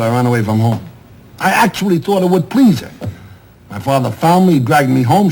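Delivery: ordinary talk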